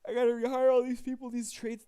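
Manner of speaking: loud high groan of frustration; exasperated outburst. Real, not acted. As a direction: complain loudly, fed up. Exasperated at tedious game chore.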